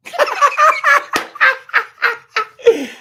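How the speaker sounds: loud burst of laughter. Real, not acted. Audience or speaker laughter.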